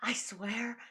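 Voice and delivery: retold pleading whisper; hushed embarrassed whisper. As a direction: breathe it out as a secret plea. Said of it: "I was, like, whispering".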